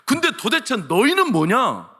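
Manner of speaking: slow, high, indignant pulpit challenge; challenging preaching. Real, not acted. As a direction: confront the congregation, stretching the key words. Preacher confronts the listeners.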